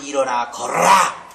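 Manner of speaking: commanding cry that bursts out on the last word; healing command. Retold or imitated. Preacher acts out healing a lame man, commanding. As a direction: order it with sudden force. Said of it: "이름으로 명하는".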